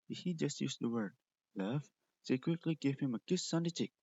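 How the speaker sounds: usual speaking voice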